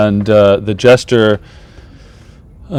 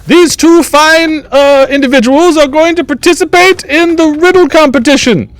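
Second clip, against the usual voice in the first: high-pitched court jester master of ceremonies; theatrical jester, far above normal pitch; jester announcing contest; 6 dB louder than usual. Acted. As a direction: announce the rules in a piping jester voice.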